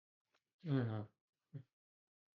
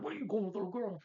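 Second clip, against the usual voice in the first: much higher, squeaky mock bear voice; a cartoonish bear taunting the girl; 9 semitones higher than usual. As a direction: play the bear teasing a little girl, pitched way up. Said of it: "This bear comes up and is like".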